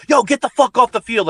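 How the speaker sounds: imitating a coach yelling high and loud; mock angry yell. Coach ordering a player off the field, imitated angry yell. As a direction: bark it like a furious coach, but only half in earnest. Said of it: "in an angry voice or yelling at him".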